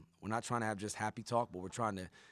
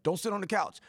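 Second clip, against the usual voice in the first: scolding parent; 9 semitones higher, 6 dB louder than usual. Retold or imitated. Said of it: "They say with kids".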